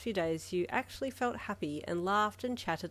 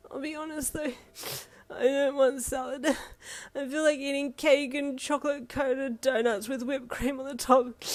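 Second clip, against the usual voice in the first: higher, teary, sniffly confession; tearful sniffling; 6 semitones higher, 4 dB louder than usual. A narrator's reading. Yin sniffling to Ida about wanting cake. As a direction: sniffle through a craving. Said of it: "you sniffed".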